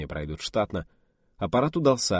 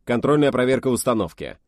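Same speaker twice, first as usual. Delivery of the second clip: a hint of a firm commander voice, louder; manly voice; 4 semitones higher, 6 dB louder than usual. Physicist opening the test, lightly manly voice. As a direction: announce it like a stern officer. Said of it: "мужественным голосом".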